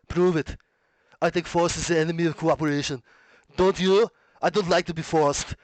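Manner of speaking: put-on Austrian action-star voice; hammed-up, forceful accented impression; Arnie. Shown as a demonstration. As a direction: declaim it like an Austrian strongman. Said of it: "we'll do like an Arnie voice".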